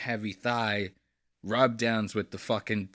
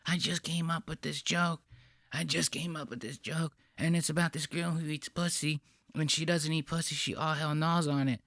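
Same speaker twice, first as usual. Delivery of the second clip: higher, soft, giddy imitation of the proud comic; mocking the comedian; 4 semitones higher, 8 dB quieter than usual. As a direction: mimic him smugly whispering his joke. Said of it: "the way he's telling her".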